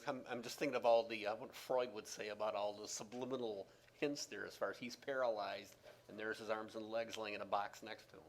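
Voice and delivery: ordinary delivery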